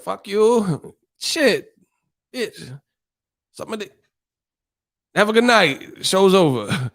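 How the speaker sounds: mock rude send-off.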